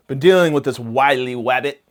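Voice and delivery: loud cartoon hunter with w for r; Elmer Fudd. Comedian battling garden rabbits, mock cartoon speech impediment. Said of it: "Now they call me Elmer Fudd".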